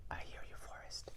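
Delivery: unvoiced, very soft whisper of "I hear you, Forest.". Shown as a demonstration. Imitating the son whispering to the forest. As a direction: breathe it as a whisper. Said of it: "whispering things and saying".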